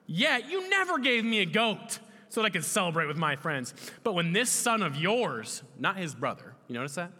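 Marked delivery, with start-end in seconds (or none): 0.1-5.6 high, resentful complaint; angry older son. Acted. Older son complaining to his father about the party, angry. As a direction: accuse your father, leaning on the words. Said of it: "Then he became angry".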